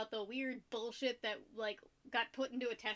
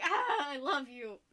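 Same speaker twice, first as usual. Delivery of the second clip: loud, drawn-out adoring cry; acted-out burst of adoration; smitten gushing; 3 semitones higher, 5 dB louder, slower than usual. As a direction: gush it slowly and loudly. Acts out own smitten reaction.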